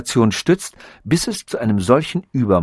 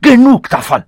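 high, loud, emphatic ranting; enraged tirade; 9 semitones higher, 6 dB louder than usual. A narrator's reading. Robot rails at stars and life's slime, enraged. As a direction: rant at full voice up to a shouted finish.

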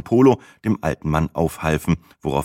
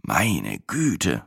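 groaning; 5 dB quieter than usual. Acted. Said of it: "stöhnte ich".

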